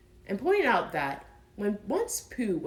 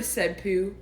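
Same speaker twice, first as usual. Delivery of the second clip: plain reading voice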